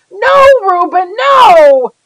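high, loud anguished screaming; desperate scream. Acted. Boy screaming at Reuben running at his dogs with an axe. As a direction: scream it with everything you have. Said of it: "I was screaming".

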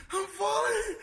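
very high drawn-out wail; escalated mock panic. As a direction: imitate her squealing in panic. Sister's panic acted again, escalating.

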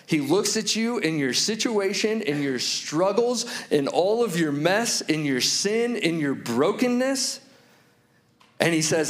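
ordinary talk

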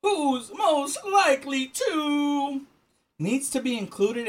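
slow, high read-out; drawn-out card reading. Real, not acted. Reading next card to friend, drawn out. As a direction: stretch each word for suspense.

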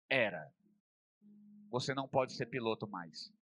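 lightly voiced doctor, low and soft; doctor's grave verdict. Médico dá a má notícia. (médico em tom baixo e seco)

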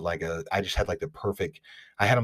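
usual speaking voice

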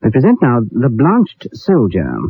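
normal talking voice

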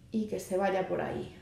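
ordinary talk, nothing marked

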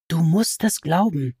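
a voice not quite her own, set apart; another inner voice. A narrator's reading. A strange voice in Alice's head urging her to believe. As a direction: speak it as an unfamiliar voice inside her head. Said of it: "zur Abwechslung mal eindeutig ihre Stimme".

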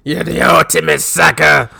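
slow, gravelly mock promo shout; Sergeant Slaughter. Podcaster as the wrestler insulting his rival, slow hammed-up growl. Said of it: "Should have called him".

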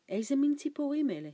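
loud, high, fast question; surprised boy asking quickly; startled question. A narrator's reading. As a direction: ask in sudden alarm.

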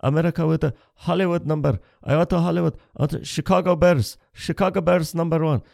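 put-on broken-English fan voice with a Middle Eastern accent; light Middle Eastern accent, eager fan. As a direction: gush about America in a Middle Eastern accent. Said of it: "Every time I see a Middle Eastern person".

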